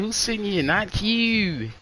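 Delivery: high, taunting put-down; mocking reply. Friend retelling the rude answer, high sneer. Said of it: "He said".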